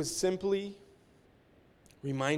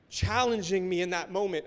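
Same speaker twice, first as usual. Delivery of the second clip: ordinary talk, nothing marked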